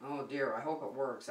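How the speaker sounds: ordinary talk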